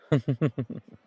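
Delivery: knowing chuckle. Acted. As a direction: chuckle confidently in a quick ripple. Suggestive chuckle about a next round.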